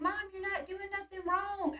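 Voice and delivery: high imitation of children's protest; voiced kids. Kids reassuring their nervous mom, imitated high voice. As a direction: play the kids, pitched well up.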